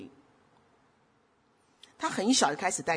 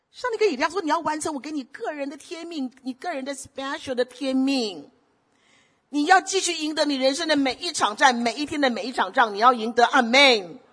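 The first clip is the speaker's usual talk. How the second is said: raised preaching voice, higher pitch; sermon exhortation, pitched up; 7 semitones higher, 3 dB louder than usual. Acted. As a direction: preach it to the congregation. Preaching again.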